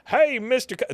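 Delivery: high, loud hillbilly holler; hillbilly act. Retold or imitated. Neighbor scaring off buyers, hammed-up hillbilly greeting. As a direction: call out like a put-on hillbilly. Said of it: "I got an idea. Follow my lead".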